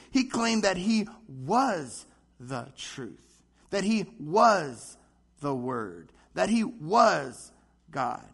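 each word slow and weighted, "he" and "was" pressed hard; slow it right down and lean on "he was"; slow emphatic claim. Real, not acted. Preacher stressing Jesus' claim.

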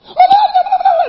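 loud, high-pitched babble of outraged yelling; mock Italian yelling. Preacher imitating the actors in the Italian commercial, mock yelling. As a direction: yell gibberish in imitation of furious Italians. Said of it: "they start yelling in Italian".

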